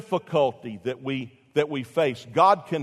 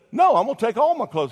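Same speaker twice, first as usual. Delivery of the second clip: put-on defiant boy; 8 semitones higher, 5 dB louder, faster than usual. Defiant boy refuses dentist.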